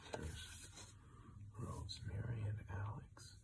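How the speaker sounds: soft unvoiced whisper; whispered aside. Real, not acted. Reader whispering who is in the picture.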